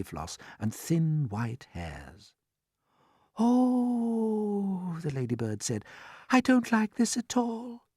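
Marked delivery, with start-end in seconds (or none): 3.4-5.0 high, drawn-out dismayed oh; a long, high wail of unease; alarmed ladybird. A narrator's reading. Frightened ladybird reacts to cloud men. 6.3-7.9 high, slow worried voice; anxious, high-pitched and slow; uneasy ladybird. A narrator's reading. Ladybird frightened of cloud men.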